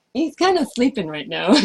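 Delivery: real laugh around the words; amused laughter with the dog asleep. Real, not acted. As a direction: laugh through the line. Laughs while reading message.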